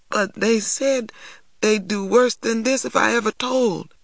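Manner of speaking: put-on a scared boy breaking into tears; crying. A narrator's reading. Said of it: "T J began to cry".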